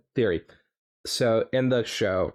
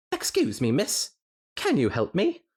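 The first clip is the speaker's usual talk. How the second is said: higher, hammed-up Scottish; attempted Scottish accent; 10 semitones higher than usual. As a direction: put on a shaky Scottish lilt. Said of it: "That was my best tenant, eh?".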